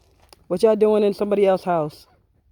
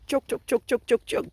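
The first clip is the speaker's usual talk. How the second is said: high clucking hen voice, pitched up; imitating chicken clucks; 6 semitones higher than usual. Owner copying her hens' chatter in a high cluck. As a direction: mimic the hens chattering back at them. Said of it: "that's you lavenders talking like that".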